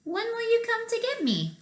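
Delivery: high little pig question. Acted. Little pig asks the wolf when, high. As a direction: ask like a small pig.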